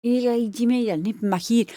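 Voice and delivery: a mock Scottish accent, a lightly put-on Scots voice; a playful Scottish accent impression. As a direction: ham up a Glasgow-style Scottish accent. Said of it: "how do you do Scottish?".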